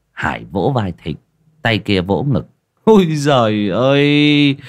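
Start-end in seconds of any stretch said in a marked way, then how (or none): 2.8-4.6 high, loud and drawn-out scoffing cry of "Ôi giời ơi"; a swaggering villager's loud exclamation; boastful exclamation. Acted. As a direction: stretch out "Ôi" high and loud, bragging to the group. Hải scoffs, slapping his chest.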